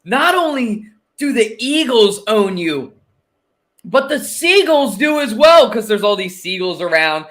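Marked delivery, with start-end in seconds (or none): 0.0-5.7 higher, slow, put-on taunting voice; sing-song gloat at a drunk fan; mocking taunt. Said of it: "and he goes".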